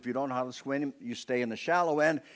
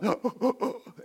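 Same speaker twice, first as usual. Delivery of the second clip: high mock gasp for air; imitated gasp; 10 semitones higher than usual. Preacher acting out the drowning boy's high gasp for air.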